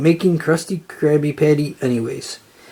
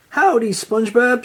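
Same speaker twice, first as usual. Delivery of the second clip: bright higher howdy, called across the ice; delighted call; 6 semitones higher than usual. A narrator's reading. Sandy greets SpongeBob on the ice, delighted higher call. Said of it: "she called, delighted".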